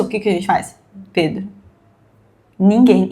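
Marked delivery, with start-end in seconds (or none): none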